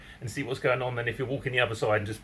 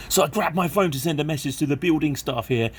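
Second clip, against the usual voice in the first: ordinary talk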